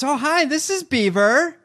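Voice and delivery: high, loud mom on the CB; high flirty voice. Retold or imitated. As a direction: play it coy and high. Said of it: "my mom goes".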